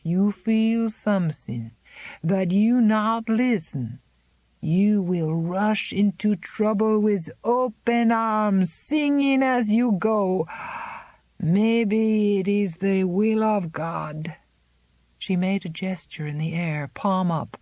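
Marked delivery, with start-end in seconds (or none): 0.0-1.7 "feel" falling, slow and certain; firm statement. Acted. Old Zélie insisting to Miranda, falling and certain. 2.2-14.4 slow, higher, louder warning voice; an old woman prophesying trouble; ominous old servant. Acted. Old Zélie foretelling Miranda's trouble, slow and ominous.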